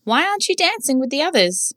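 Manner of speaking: put-on very high, eager child voice; polite little boy. A narrator's reading. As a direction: ask sweetly to be let in. Barry asks ballerina.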